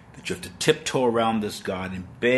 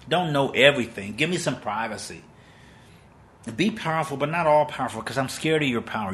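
higher, pleading mock prayer to God; frightened pleading prayer; 5 semitones higher than usual. A frightened believer pleading with God, higher and begging. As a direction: beg like a scared believer.

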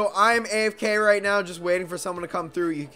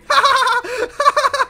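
loud, high giggle fit; high cackling laugh; 7 dB louder than usual. Real, not acted. Gamer mocking a stuck enemy, cackling. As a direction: laugh hard at the helpless enemy. Enemy can't hit him.

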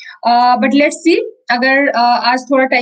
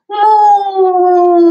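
long high moo, stretched and louder; mooing rabbit; 6 semitones higher, 3 dB louder than usual. Acted. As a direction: moo out like a cow for the children. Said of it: "rabbit is saying".

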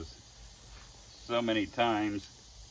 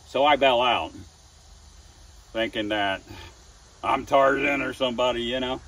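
excited retelling; 5 semitones higher, 5 dB louder than usual. Real, not acted.

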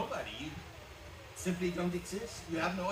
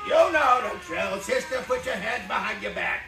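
higher, much louder tough cop command; loud cop order; 5 semitones higher, 11 dB louder than usual. Acted. Police officer loudly ordering a woman to turn around. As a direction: bark the arrest like a street cop.

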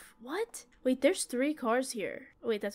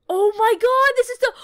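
high, loud cry of horror; panicked shout; 11 semitones higher, 13 dB louder than usual. Real, not acted. Player finding the stalker's hideout, panicked high shout.

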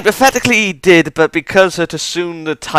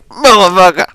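loud, higher curse of frustration; loud swear at the cliffhanger; frustrated yell; 6 semitones higher, 9 dB louder than usual. Retold or imitated. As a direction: shout it at the screen. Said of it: "I was just like".

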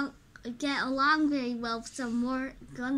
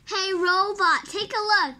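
loud, higher call to the robot; louder, higher hey robot; 6 semitones higher, 8 dB louder than usual. Acted. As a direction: get the robot's attention from across the room. Calls out to the robot.

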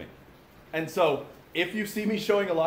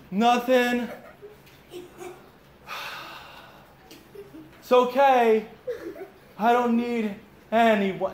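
high, loud, hammed-up sulking with a laugh; overdone pouty martyr, pitched way up; mock sulky friend; 8 semitones higher, 5 dB louder, much slower than usual. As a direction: play the moping guy fishing for sympathy. Mocking sulky friend's replies.

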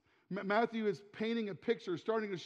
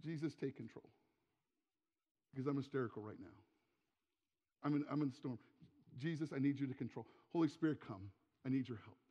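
soft, low acted prayer; hushed surrender to Jesus, acted out; quiet plea; 5 semitones lower, 5 dB quieter, faster than usual. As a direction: pray humbly for help, voice dropped. Acts out prayer in distress.

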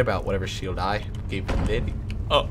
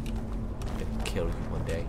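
quiet mock threat; 7 semitones lower, 6 dB quieter than usual.

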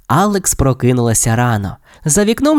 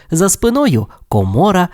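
ordinary delivery

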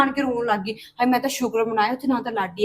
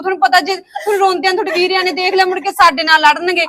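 higher, louder urgent comforting; raised, frantic reassurance; loud anxious pleading; 5 semitones higher, 8 dB louder than usual. Acted. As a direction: beg her to stop crying, urgently. Pleading with crying sister-in-law.

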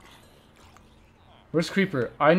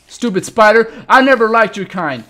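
mock angry yell; 5 semitones higher, 10 dB louder than usual. Real, not acted. Yelling at the spider.

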